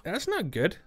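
high, fast whiny protest; high panicky mock whine; mock scared. Real, not acted. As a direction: fake fright at a friend. Friend telling scary story.

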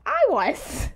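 exasperated cry; indignant outburst. Real, not acted. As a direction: burst out in real indignation. Indignant at mother over breakup.

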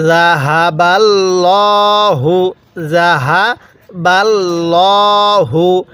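ল্লা in জাহাবাল্লাহু said full and heavy; teacher models the heavy lam of Allah. Shown as a demonstration. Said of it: "লামটাকে মোটা করে পড়ব".

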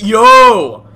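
loud, high yell; excited shout. Real, not acted. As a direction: shout it out with thrill. Drifting the car.

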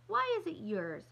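high, loud, curious question; puzzled child. A narrator's reading. Kirsty asking Ellen about the New Year, puzzled. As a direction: pipe up like a puzzled little sister.

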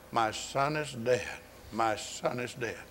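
mournful lament, low and soft; subdued, slightly slow imitation of grief; retold weeping. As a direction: quietly voice a grieving mother, without real sobs. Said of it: "she's weeping and crying".

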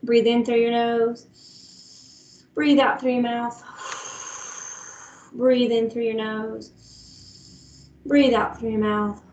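slow, drawn-out breathing instruction; calming breathing cue. Shown as a demonstration. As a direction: stretch out each breath cue to lead the class. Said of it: "Let's find that steady beat".

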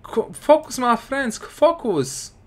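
high-pitched, pleading call to focus; frantic high plea; urging. Real, not acted. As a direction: beg the team to concentrate. Urging his players.

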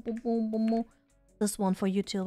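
ordinary talk, nothing marked

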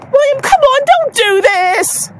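high plea slowing at the end; pleading call. Real, not acted. Mom begging her runaway son to stop, pleading call. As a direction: beg him to stop, drawing it out.

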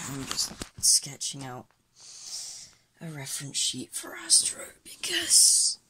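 soft late-night whisper. Real, not acted. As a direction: whisper so no one wakes. Late night quiet sketching.

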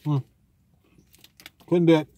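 talking while chewing; muffled through food; mouth full. Real, not acted. Seen 0: Big bite taken at -3; chewing, food visible in open mouth at +0.6.

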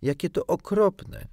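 high, loud cry of pity; high-pitched, pitying exclamation; dismayed sympathy. A narrator's reading. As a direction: exclaim it, feeling sorry for him. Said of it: "zrobiło jej się strasznie żal Piotrusia".

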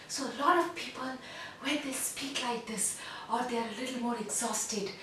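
soft, airy, breath leaking out; breathy weak voice. Shown as a demonstration. Coach showing a weak breathy voice. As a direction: let the air spill past the words. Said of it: "the one that I just demonstrated".

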